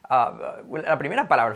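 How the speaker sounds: normal talking voice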